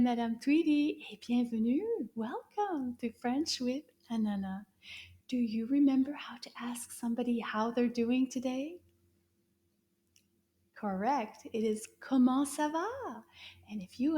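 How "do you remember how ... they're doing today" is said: a secret-sounding whisper to pupils. Acted. Teacher quizzes the class in a whisper.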